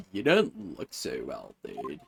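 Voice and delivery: deep, slow voiced game character; low character voice. Voicing game character line.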